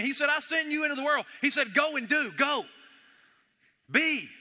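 rally them to go and do; rousing call to action; fervent preaching. Real, not acted. Climactic exhortation.

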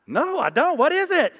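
high, loud clueless answer; high-pitched puzzled reply to a stranger; naive reply. Retold or imitated. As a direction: sound genuinely clueless and eager. His own naive reply in the story.

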